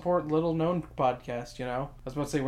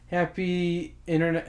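ordinary talk, nothing marked

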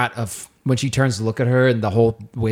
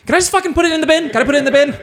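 exasperated; 6 dB louder, much faster than usual. Retold or imitated. Exasperated at airport security.

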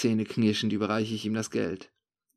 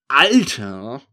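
put-on loud drunken call; slurred shout; 2 semitones higher, 11 dB louder than usual. A narrator's reading. Drunk narrator yelling into the thief's ear, slurred shout. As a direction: slur it loudly right into his ear. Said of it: "rufe ich ihm lallend ins Ohr".

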